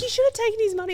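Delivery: mock-scolding friends. Friends teasing her for refusing the cash, high and loud. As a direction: tease her loudly for missing out. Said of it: "they were like".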